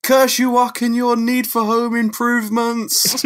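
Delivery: high, loud, slow hammed-up villain-style rant; mock ranting curse. Angry Peter rants at the wall, high, loud and slow. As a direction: shake both fists and curse the sky, hammed up. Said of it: "just ranting at the hole in the wall".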